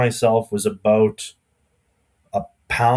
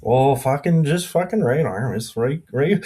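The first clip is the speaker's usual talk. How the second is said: hammed-up Alberta accent; playful rural Alberta accent; 4 semitones higher than usual. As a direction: put on a thick Alberta shop-guy accent. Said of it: "how's your Alberta accent there".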